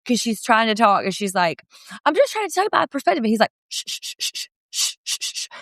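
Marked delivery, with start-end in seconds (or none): 2.1-3.2 mock quote. Quoting wife, high and fast. 3.7-5.5 repeated unvoiced shushing; long run of hissed shh sounds. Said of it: "shushing her".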